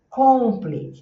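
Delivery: "complete" with a loud, round O in the first syllable; Brazilian mispronunciation. Retold or imitated. Teacher imitates Brazilian learners, wrong rounded O. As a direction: say "complete" as "COM-plete", full egg-shaped O. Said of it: "o brasileiro falar assim".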